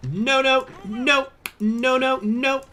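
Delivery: high, loud alarmed no no no; rising panic, no no no; panicked high no's. Real, not acted. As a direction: shoot up in pitch, panicking. Game going wrong, high cries.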